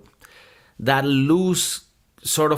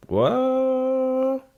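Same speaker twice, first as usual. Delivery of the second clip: high squeaky what; mock surprise; 13 semitones higher than usual. Rapper cuts in on himself, mock surprised.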